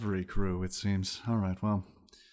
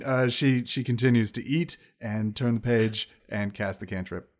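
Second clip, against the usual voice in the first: normal talking voice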